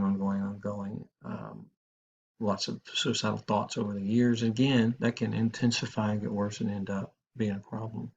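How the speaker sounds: ordinary delivery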